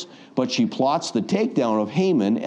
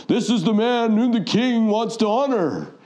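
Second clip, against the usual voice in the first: herald's proclamation; 10 semitones higher than usual. Retold or imitated. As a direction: call it out like a town crier. Herald proclaiming through town.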